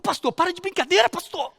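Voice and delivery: high, upset protest to the pastor; acted distress, high voice dropping off at the end; imitating tearful pleading. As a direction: plead as if close to tears. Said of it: "E chorando, irmãos, e chorando, e tremendo".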